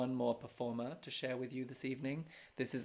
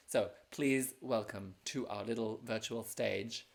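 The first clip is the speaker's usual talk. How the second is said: big announcer build-up; 5 dB louder than usual. Acted. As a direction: welcome the act like a show host. Host introducing the performer.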